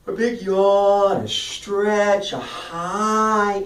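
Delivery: high, loud, stretched-out yawning words; drawn-out warm-up. Shown as a demonstration. Coach demonstrating a mouth-loosening warm-up, high and drawn out. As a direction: open up wide and sustain each word high and loud. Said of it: "then you do something really simple".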